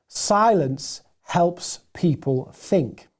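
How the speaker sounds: slow, measured delivery; slow and deliberate. Shown as a demonstration. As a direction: let each word sit. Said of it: "Silence is a very powerful tool".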